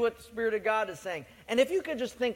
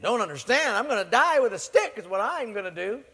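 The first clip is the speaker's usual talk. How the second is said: exasperated retort; 4 semitones higher, 8 dB louder than usual. Acted. Livingstone snaps back at servant.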